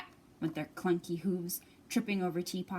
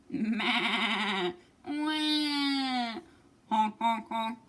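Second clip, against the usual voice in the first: animal chaos; 3 dB louder, much slower than usual. Acted.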